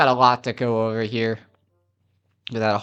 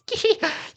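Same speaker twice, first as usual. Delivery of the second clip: very high gleeful titter; short cartoon cackle, pitched way up; inventor's giggle.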